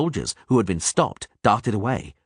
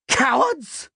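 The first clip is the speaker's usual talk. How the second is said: roar. A narrator's reading. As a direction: shame the deserters. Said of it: "Dandelion roared in a voice".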